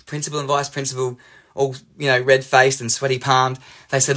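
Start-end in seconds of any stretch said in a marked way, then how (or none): none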